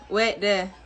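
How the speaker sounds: normal talking voice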